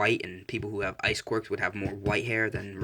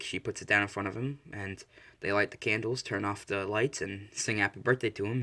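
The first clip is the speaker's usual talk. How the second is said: ordinary delivery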